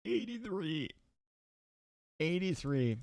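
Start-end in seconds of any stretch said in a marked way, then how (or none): 0.1-0.9 high, soft inhaled alien voice. Pretend. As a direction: talk like a clicking alien. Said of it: "that was my alien voice".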